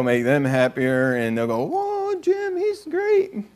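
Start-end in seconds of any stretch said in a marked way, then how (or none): 1.6-3.4 very high, slow, dazzled praise; hammed-up awe at Jim; mock awed admirer. Admirers marvelling at funny Jim, high slow awe.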